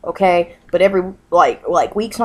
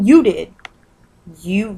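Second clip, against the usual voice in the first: ordinary delivery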